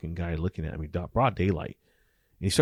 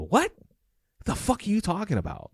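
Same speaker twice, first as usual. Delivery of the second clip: shocked disbelief; 13 semitones higher than usual. Retold or imitated. Shocked high outburst. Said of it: "I go".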